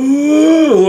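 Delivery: high, loud mock zombie "ooh"; a hammed-up undead wail at her; zombie moan.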